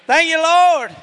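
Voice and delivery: high, loud praise shout; full-voiced, high-pitched shout of thanksgiving. Real, not acted. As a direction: cry it out to rouse the congregation. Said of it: "give with a big old shout of praise".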